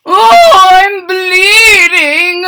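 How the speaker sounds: high, loud, slow yelp; yelping fox. Acted. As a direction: cry out in pain. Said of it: "the fox yelped".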